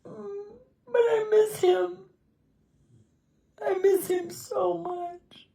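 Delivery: high, soft and slow, crying; aching, tearful confession. Real, not acted. Widow confessing she misses her husband, crying softly.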